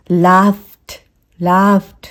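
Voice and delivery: लाफ्ड as "laaft"; tiny t closing लाफ्ड; one-syllable लाफ्ड; soft T ending. Shown as a demonstration. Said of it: "इन दोनों के बीच का एक sound".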